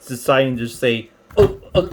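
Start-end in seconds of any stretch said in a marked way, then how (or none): 1.4-1.9 high, loud mock sputter; hammed-up strained stammer, pitched up; struggling gadget. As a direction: play a machine choking and giving up. Voices the struggling, failing charger.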